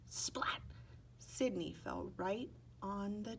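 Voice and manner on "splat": whispered splat. A narrator's reading. Sound effect read aloud.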